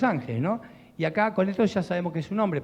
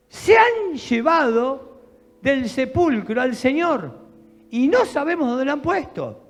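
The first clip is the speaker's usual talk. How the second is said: distressed Mary; 7 semitones higher, 5 dB louder, slower than usual. Acted. Mary tells disciples tomb empty.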